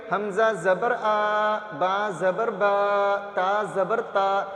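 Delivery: stretched-out wrong reading of the vowels; dragged vowels. Shown as a demonstration. Teacher showing pupils the wrong, dragged reading. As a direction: drawl each syllable slowly and sing-song. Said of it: "تو کیا ہے انداز ختم ہو جاتا ہے".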